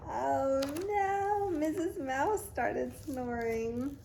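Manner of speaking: ordinary delivery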